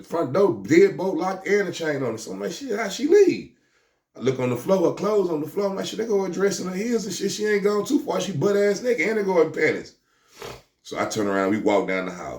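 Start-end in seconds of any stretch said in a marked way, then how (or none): 2.5-3.5 high, slow baffled question; finding the chained front door still locked; puzzled wonder. Retold or imitated. 5.9-10.0 high, astonished thinking aloud; finding her clothes on the floor; amazed reasoning. Retold or imitated. Reasoning about missing woman.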